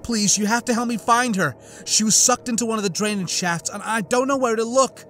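desperate plea. A narrator's reading. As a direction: beg her for help. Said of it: "her voice tinged with desperation".

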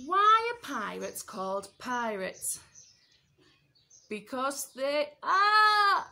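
slow, loud crying squawk; squawked joke. Acted. Parrot squawking its joke, loud and slow. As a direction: squawk the punchline as loud as you can.

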